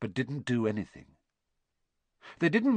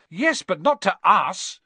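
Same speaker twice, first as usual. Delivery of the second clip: slow, loud, high exasperation; exasperated; 7 semitones higher, 7 dB louder than usual. A narrator's reading. Ron to stubborn Neville, exasperated. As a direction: drag it out in exasperation. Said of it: "said Ron in exasperation".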